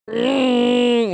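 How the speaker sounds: exaggerated struggling gamer's moan; imitated groan.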